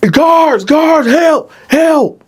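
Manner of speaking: high, loud cry for help; calling for help. Retold or imitated. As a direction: yell for the guards. Dylan calling for guards.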